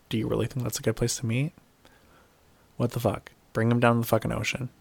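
ordinary talk

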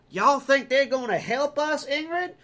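high, loud hillbilly twang. Retold or imitated. Game survivors gossiping as the player leaves, hammed-up hillbilly accent. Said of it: "stereotypical hillbilly hick redneck voice".

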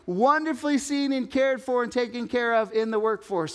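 high, loud, hammed-up voice of a business consultant; preacher mimics the consultant mocking the dignity trend, high and loud; mock Patrick Lencioni. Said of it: "And he's like".

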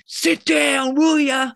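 high, loud mock shout at the crowd; retold heckler yell. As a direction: bark it like an annoyed man in the balcony. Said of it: "this guy screaming at us".

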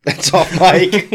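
mocking echo. Cohost mockingly repeats Bert's off-mic remark, slow and laughing. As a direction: echo it back slowly to poke fun, giggling. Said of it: "That's how we're referring to things now".

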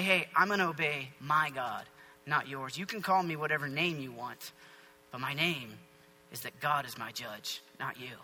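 low, soft and firm stand; quietly resolute. Acted. Daniel refusing the king's demands, quietly resolute. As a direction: hold your ground in a low, quiet voice.

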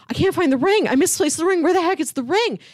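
a mock panic over a lost ring; frantic searching. Gordon searching the house before proposing, mock panic.

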